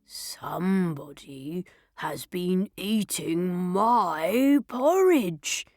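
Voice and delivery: slow, drawn-out bear growl; big papa bear grumbling; growling Daddy Bear. A narrator's reading. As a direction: growl it slowly, stretching the words. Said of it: "he growled".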